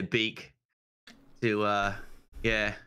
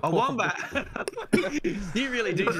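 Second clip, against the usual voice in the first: laughing; 11 semitones higher, faster than usual. Real, not acted. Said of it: "laughing at S2's question".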